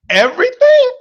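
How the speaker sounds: high, loud shout of "Everything"; hype shout. Acted. Hyping the crowd.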